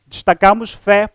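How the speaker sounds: ordinary delivery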